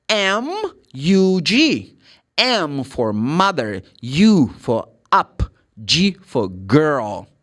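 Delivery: slow, deliberate letter-by-letter spelling; teacher carefully spelling aloud. Shown as a demonstration. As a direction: spell it out slowly, one word per letter. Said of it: "You're going to ask about the spelling".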